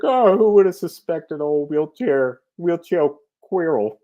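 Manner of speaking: high, loud mock gasp of surprise; mock shocked aside. Mocking the obvious twist, playing fake shock for listeners. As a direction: ham up the fake shock as if nobody saw it coming. Mock reveal about Quirrell.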